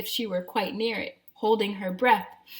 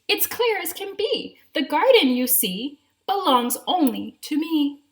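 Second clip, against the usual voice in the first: proud gardener; 7 semitones higher, 6 dB louder than usual. Acted.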